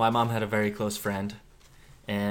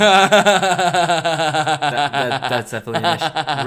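loud, laughing mock friend voice; loud obnoxious impression; 5 semitones higher, 11 dB louder, much slower than usual. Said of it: "Really loud and obnoxious".

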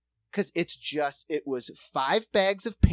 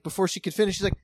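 ordinary talk

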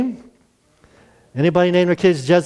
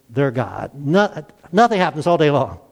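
normal talking voice

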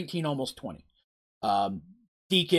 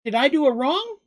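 high, puzzled question from a little gully; confused; 10 semitones higher than usual. A narrator's reading. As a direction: ask it unsure, pitched well up. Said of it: "Mugen looked around confused".

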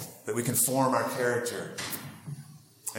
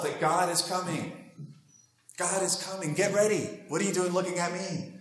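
higher-pitched, lightly acted prophet's proclamation; urgent heralding; 7 semitones higher than usual. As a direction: announce the arrival as an urgent herald. Voices John the Baptist heralding God.

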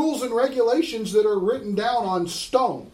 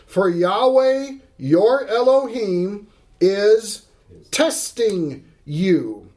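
slow scripture reading; 3 dB louder, slower than usual. Acted. Preacher reading scripture with weight.

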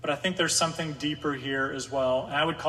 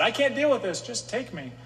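despairing plea; 7 semitones higher than usual. Retold or imitated. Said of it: "Just like Moses had when he said".

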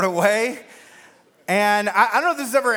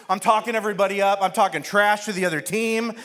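ordinary delivery

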